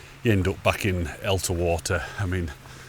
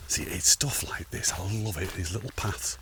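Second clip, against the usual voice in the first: hushed; 7 dB quieter than usual. Real, not acted. Whispering on the path.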